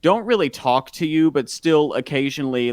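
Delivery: loud, high toastmaster delivery; proclaimed toast. Pretend. Host toasting neighbors on a podcast, loud proclaiming voice. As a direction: raise a glass and proclaim it to the room.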